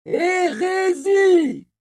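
very high, loud and very slow cry; cry of heresy. A narrator's reading. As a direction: stretch out the accusation. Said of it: "lança un autre encore". (un autre lance le mot, très lent, aigu et fort)

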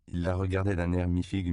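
ordinary delivery

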